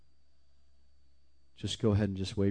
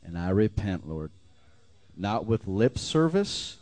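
preaching at full force. Acted. Preacher urging the congregation to real repentance, full force. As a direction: drive it home to the congregation.

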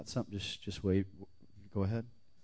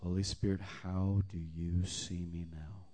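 softer, slow, hushed; soft hushed prayer; 7 dB quieter, slower than usual. Shown as a demonstration. Preacher quietly modelling a hushed prayer. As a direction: pray it gently and slowly. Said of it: "Don't brush off the whisper".

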